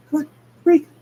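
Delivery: high, loud urging; high straining plea. Acted. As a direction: beg the bottle to break. Sonic straining at bottle.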